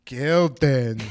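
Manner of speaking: loud, dopey echo of the recording; exaggerated dim-witted mimicry; mocking dopey imitation. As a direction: parrot the slowed-down word mockingly. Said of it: "You can't put tard voice".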